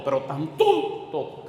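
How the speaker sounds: "tontos" hugely drawn out; exasperated emphasis on "tontos". Real, not acted. Preacher laments how foolish we are, drawing out "tontos". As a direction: stretch "tontos" slowly in exasperation.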